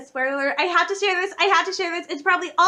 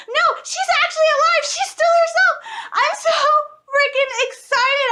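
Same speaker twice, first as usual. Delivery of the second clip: excited squeal; 10 semitones higher, 3 dB louder than usual. Real, not acted. Fan overjoyed Ahsoka is alive.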